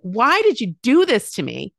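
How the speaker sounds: mock yell of an upset teenager; retold yell. Teen son yelling at mom in the kitchen, frustrated. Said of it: "he just yelled at me".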